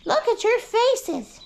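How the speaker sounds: slow high pet-talk coo. Real, not acted. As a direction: stretch out "Look at your faces" high like talking to babies. Repeats to ducks, cooing.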